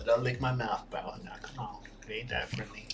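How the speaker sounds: ordinary talk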